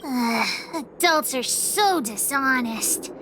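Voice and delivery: slow, low grumble of disgust; exasperated grumble. Acted. Child detective fed up with adults, slow exasperated grumble. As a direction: drag it out, fed up with them.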